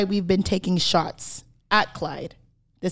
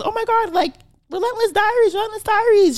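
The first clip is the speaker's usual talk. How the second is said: much higher squealing fan voice; excited fan girl; 12 semitones higher than usual. Retold or imitated. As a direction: gush like a starstruck fan. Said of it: "some girl goes".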